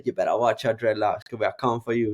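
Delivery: a light Jamaican accent, half-hearted imitation; a hint of Jamaican accent in a mocking boxer impression. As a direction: slip into a Jamaican accent as a joke. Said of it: "Jamaican accent".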